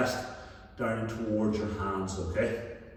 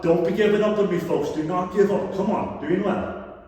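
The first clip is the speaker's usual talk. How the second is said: loud, higher pleading coach; shouting encouragement; 7 semitones higher, 5 dB louder than usual. Real, not acted. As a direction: drive them through the burn. Coach urges class not to quit.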